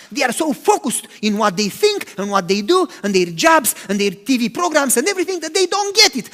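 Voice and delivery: ordinary talk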